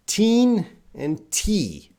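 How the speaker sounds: put-on "Teen and T" drawn out slowly, the teen and the T sharply set apart; clear contrast. Shown as a demonstration. Teacher warning the class not to mix up teen and T. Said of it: "you gotta be very clear with that".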